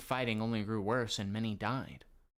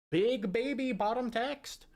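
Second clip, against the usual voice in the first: higher, slow, drawn-out meme chant; 12 semitones higher, 4 dB louder than usual. Shown as a demonstration. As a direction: stretch Big baby bottom text like a meme caption.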